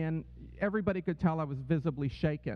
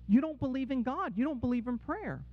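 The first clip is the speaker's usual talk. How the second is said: higher-pitched mock disbelief; retold incredulous challenge; 6 semitones higher than usual. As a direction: imitate a mother challenging her son, pitch raised. Mother challenges son in retold quote.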